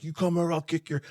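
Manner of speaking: high, heated mock threat; retold furious threat, pitched high; 1 angry threat. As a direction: snap it out like you're ready to fight. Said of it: "I'm like".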